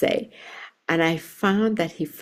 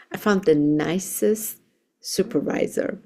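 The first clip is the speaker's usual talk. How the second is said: ordinary talk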